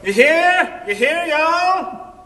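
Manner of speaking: high, loud, slow yelled call; student yelling to the neighbors out the window. Retold or imitated. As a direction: call out to the whole street. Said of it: "she yells out the window".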